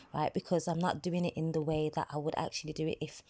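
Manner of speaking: ordinary delivery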